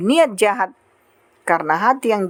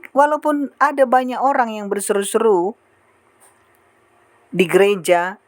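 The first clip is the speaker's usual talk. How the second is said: ordinary talk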